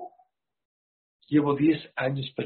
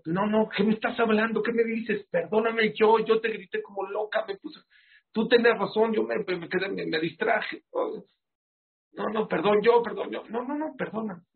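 higher, flustered woman tripping over her apology; embarrassed lady apologizing; 7 semitones higher than usual. Retold or imitated. Lady caught out, stammering a higher-pitched apology. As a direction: play the lady who wants to hide under her chair. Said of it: "Esta se quería meter abajo de la silla".

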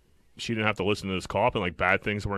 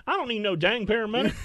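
higher-pitched Southern lady, lightly hammed; mock southern lady; 10 semitones higher than usual. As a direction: pitch up into a cranky Southern grandma. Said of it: "That was good".

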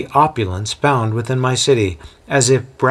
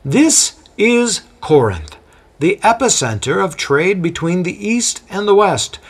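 proud exclaim; 9 semitones higher than usual. A narrator's reading. Guide proudly presenting Corinth to his guest, mildly grand. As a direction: present the city like a grand prize. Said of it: "the man proudly exclaims".

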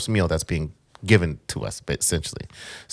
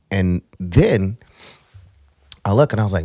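usual speaking voice

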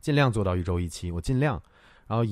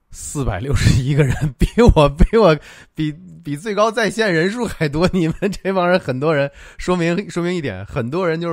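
excited; 6 semitones higher, 8 dB louder than usual. Real, not acted. Surprised at big raffle turnout.

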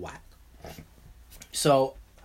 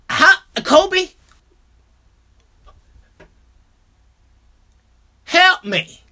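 high, loud mock pleading; mock plea; 11 semitones higher, 7 dB louder, much slower than usual. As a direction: beg Kobe for help, hammed-up. Pleading to Kobe in mock despair.

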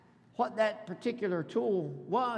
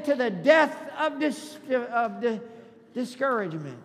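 loud start, stumbling on the last word; faltering rallying cry; 3 semitones higher, 6 dB louder than usual. Shown as a demonstration. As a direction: call it out loudly, tripping then recovering. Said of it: "Say it again".

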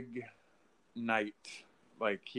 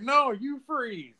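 slow, much higher mock comeback; hammed-up high goon retort; imitating the henchman; 13 semitones higher, 3 dB louder, slower than usual. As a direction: stretch it out like a cocky goon.

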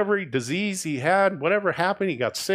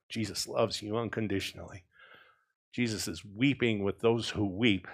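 low, softened, sincere comfort; soft reassurance; 6 semitones lower, 9 dB quieter than usual. Real, not acted. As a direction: lower your voice to comfort the congregation. Preacher reassures the congregation.

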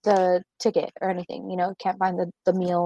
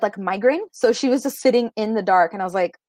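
ordinary talk, nothing marked

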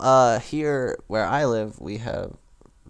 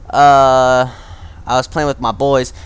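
ordinary delivery

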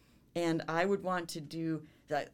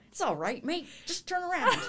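put-on terrible Australian accent; 13 semitones higher, 4 dB louder than usual. Imitating Siri guiding her after a wrong turn, in a laughing fake Australian accent. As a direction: ham up a cheery Aussie Siri voice through laughter. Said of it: "that was a terrible Australian accent there".

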